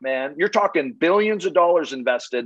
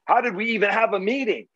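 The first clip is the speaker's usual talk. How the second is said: higher, incredulous "dude" complaint; an exasperated homeowner scoffing at the pitch, pitched up; exasperated client; 6 semitones higher than usual. Retold or imitated. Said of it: "to be like".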